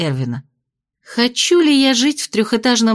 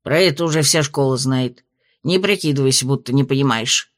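snorting dismissively; 7 semitones lower than usual. A narrator's reading. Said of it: "фыркнул дракончик".

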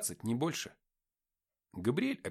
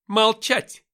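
much higher, very loud bark; barked order; 12 semitones higher, 16 dB louder than usual. Acted. Gabriel cutting off the quarreling men with a bark. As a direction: snap them silent at once. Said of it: "рявкнула Габриэль".

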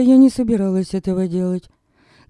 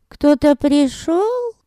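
put-on old woman voice; 11 semitones higher, slower than usual. Old woman calling from inside.

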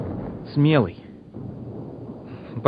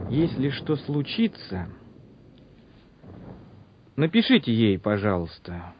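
a hushed, slowed-down worried plea; quiet plea; 3 semitones lower, 6 dB quieter than usual. Acted. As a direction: ask it gently as if it may be the last request. Soldier asks officer to write home.

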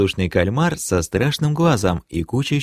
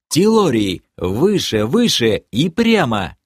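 raised, urgent call to the beast; shouted command; 5 semitones higher, 3 dB louder, slower than usual. A narrator's reading. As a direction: call out steering orders, a mild shout. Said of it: "я крикнул". (крикнуть команду, чуть сдержанно)

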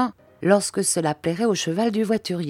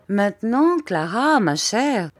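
lightly voiced admonishing husband; higher, swooping, measured address; controlling husband; 5 semitones higher, slower than usual. A narrator's reading. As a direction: caution your wife with a slight raised lilt. Stepfather checks his wife.

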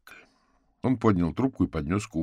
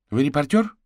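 woman's question; 10 semitones higher, 9 dB louder than usual. A narrator's reading. Mother questions stranger warily.

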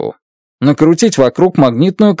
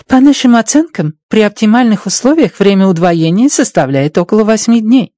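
put-on higher voice with a Chinese accent; 8 semitones higher than usual. A narrator's reading. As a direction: speak Russian with a Chinese accent. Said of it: "произнесла с китайским акцентом".

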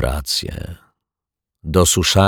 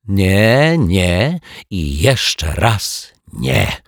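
forceful refusal; 2 semitones higher, much slower than usual. A narrator's reading. Mrs Majewska refusing the detectives, forceful and slow. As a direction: hammer each no home slowly. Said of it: "Głos pani Majewskiej znowu nabrał mocy".

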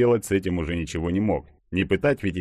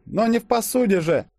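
high, flustered protest; taken-aback waitress; 4 dB louder than usual. A narrator's reading. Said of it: "опешила официантка". (опешившая официантка, высоким голосом)

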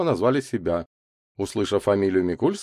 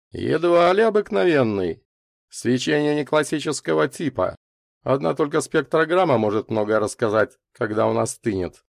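lightly voiced expert, raised above narration; thoughtful scientist; 7 semitones higher, 4 dB louder than usual. A narrator's reading. Scientist answers Славка about the meteorite, thoughtful. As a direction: correct them, easing down toward the end. Scientist's reply in story dialogue.

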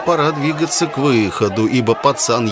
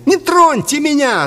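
very high, agitated cry; frantic boy; 4 dB louder than usual. A narrator's reading. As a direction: fend him off in falsetto. Boy pulls away from priest.

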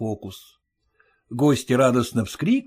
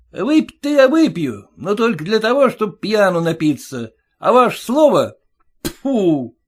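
a high, pinched voice, louder, needling the father-in-law; mocking groom; 7 semitones higher, 4 dB louder than usual. Groom taunting his stingy father-in-law, high and mocking. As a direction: jeer at him in a sing-song.

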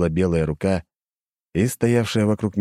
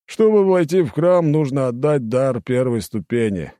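lazy; 8 semitones higher, 4 dB louder than usual. Acted. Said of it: "лениво пояснил он".

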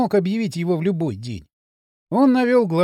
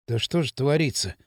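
low, softer distressed murmur; dejected mutter; 5 semitones lower, 4 dB quieter than usual. A narrator's reading. Кирилл mutters at the drunken scene, dejected. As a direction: mutter it under your breath, mildly crushed. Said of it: "сокрушённо пробормотал Кирилл".

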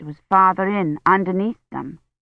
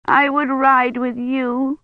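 put-on sobbing child; 6 semitones higher, slower than usual. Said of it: "she sobbed".

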